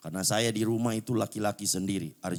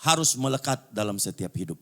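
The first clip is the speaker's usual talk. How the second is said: loud, high-pitched sermon delivery; full-force preaching; 11 semitones higher, 8 dB louder than usual. Real, not acted. Preacher urging believers back to Jesus' teaching, full-force preaching. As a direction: preach it out at the top of your voice.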